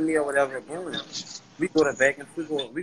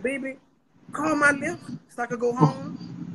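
higher and slow, coaxing; sweet-talking plea; 10 semitones higher than usual. Retold or imitated. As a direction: sweeten it up to get your way. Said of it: "I'm like".

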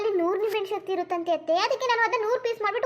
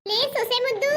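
coaxing call; 6 semitones higher, 7 dB louder than usual. Acted. Mother-in-law calls daughter-in-law.

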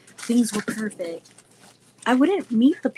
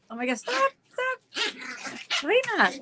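high, softer off-mic calls; scolding the dog; 11 semitones higher, 4 dB quieter, slower than usual. Real, not acted. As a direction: break off to shoo a pet. Interrupted by her dog.

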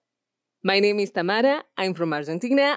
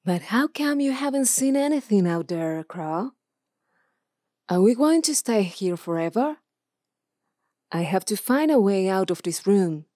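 adventurer heroine. Shown as a demonstration. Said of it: "Lamia Croft".

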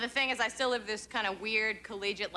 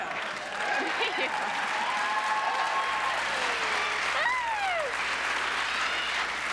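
high giggle. Real, not acted. High laugh after punchline.

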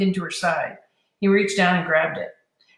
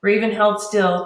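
plain reading voice

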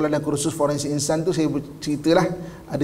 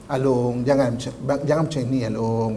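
put-on low, tender coaxing of a child; gentle coaxing; 6 semitones lower than usual. Shown as a demonstration. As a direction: gently plead in a lowered voice. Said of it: "kan maksudnya kan, lembut".

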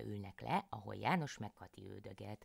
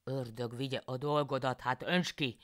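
louder exasperated husband; annoyed husband János; 3 semitones higher, 5 dB louder than usual. A narrator's reading. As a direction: curse her and let her do it. János gives in again.

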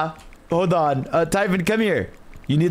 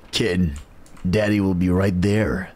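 much lower, deep put-on dad voice; deepest voice; 11 semitones lower than usual. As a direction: drop as deep as you can go. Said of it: "And your deepest voice ever".

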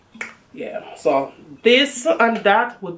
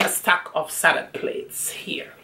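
hushed aside about the find; whispering; 6 dB quieter than usual. Real, not acted.

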